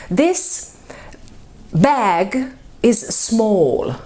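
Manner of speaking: slow, deliberate teacher read; slow model sentence. Shown as a demonstration. Teacher to class, slow demo. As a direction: say it slowly for learners. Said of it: "as I wrote it here".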